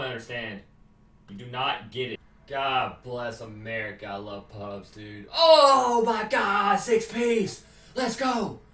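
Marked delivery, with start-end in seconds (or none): 5.3-8.6 slow, loud thrilled shout; excited shout. Real, not acted. Gamer celebrating a six-kill streak, excited shout. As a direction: yell with delight at the kill.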